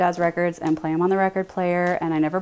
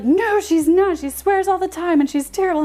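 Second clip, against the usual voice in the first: much higher and louder mock protest; mocking husband; 12 semitones higher, 5 dB louder than usual. Mimicking husband telling chat she swears, high loud squeal. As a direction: squeal it like a teasing tattletale. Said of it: "He's like".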